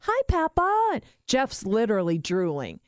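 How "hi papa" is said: put-on high, sing-out child's hello; little boy. Grandson greeting his drooling Papa, childlike. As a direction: imitate a young kid's eager hello. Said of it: "Tyler was little".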